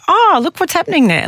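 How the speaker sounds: high, light mock surprise; amused noticing. Observing your own mind with interest, high mock surprise. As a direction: point it out with curious amusement. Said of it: "that moment where you go".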